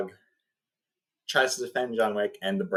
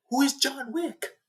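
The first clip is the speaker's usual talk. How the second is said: much higher, slower, a strained imitated young voice; whiny panicked son. Bratty son asks his father, a high panicked imitation. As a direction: squeak out the question like a scared rich kid.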